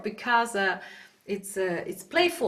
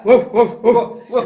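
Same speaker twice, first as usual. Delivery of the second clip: loud short chesty woofs; big dog bark; 2 semitones lower, 11 dB louder than usual. Shown as a demonstration. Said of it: "Like a big dog".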